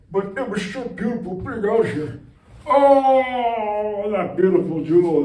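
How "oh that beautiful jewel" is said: mock awe. Awe at planet Earth.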